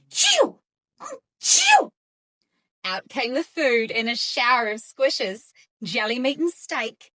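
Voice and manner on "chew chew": very high, loud sneezing chew; huge sneeze. A narrator's reading. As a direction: sneeze it out big. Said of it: "sneezed a huge sneeze".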